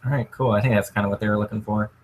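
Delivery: usual speaking voice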